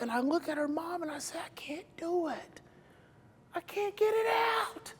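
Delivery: hushed, breathless and defeated; whisper. Retold or imitated. Exhausted, telling her he can't.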